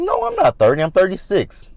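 high-pitched mock insistence; squeaky send-up of a denial; mimicked indignant protest. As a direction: imitate her protesting, voice shot up high and loud. Mimicking castmate insisting on her age.

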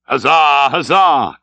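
loud, drawn-out huzzahs; lusty cry of approval; sailors' cheer. Acted. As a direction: cheer like a whole crew. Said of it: "cried the seamen".

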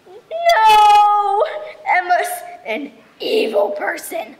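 loud, high wailed no, then slow accusation; melodramatic, indignant outburst; outraged protest. Acted. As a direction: howl it out and drag the words. Angry dog rants about Emma.

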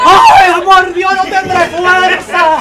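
loud, high melodramatic wailing; hysterical wailing wife. Acted. Wife to doctor, loud high hammed-up wailing. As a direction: howl it like a soap opera widow.

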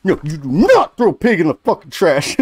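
loud, high indignant protest; angry outburst. Retold or imitated. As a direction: yell it like you're outraged. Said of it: "I'm like".

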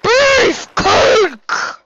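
high, harsh mock shout; hammed-up battle cry. Player yelling a nickname in the game, hammed-up shout. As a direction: bellow the name like a silly war cry.

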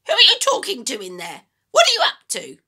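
put-on high, loud screechy old woman; screechy grandma voice. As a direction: nag him sharply from the next room. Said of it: "came the screechy voice from the next room".

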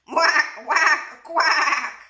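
sleeping duck quacking loudly; snoring duck. A narrator's reading. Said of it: "Duck snored".

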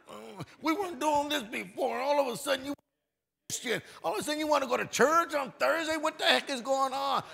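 high, loud, incredulous mock wife; a husband imitating his wife's complaining. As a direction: mimic a nagging wife's disbelief. Wife mocking her husband's new churchgoing.

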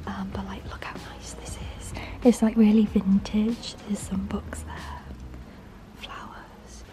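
hushed whisper, trying not to be overheard. Real, not acted. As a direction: whisper quietly through the room. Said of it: "I have to whisper 'cause it's a cleaner".

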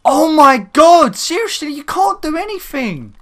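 high-pitched cry, loud then easing off; exasperated outcry. Real, not acted. Gamer unable to do anything, exasperated outcry.

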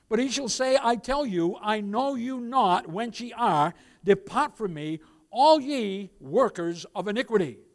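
loud, slow pronouncement of judgment; preacher voicing Christ's rejection, loud; solemn preaching. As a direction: deliver the verdict with weight. Reads Christ's judgment aloud.

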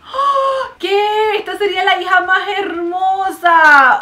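high, loud squeal of wonder; amazed gush. Real, not acted. Vlogger seeing the imagined child of Marinette and Luca, amazed shout. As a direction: gasp then shout it in awe.

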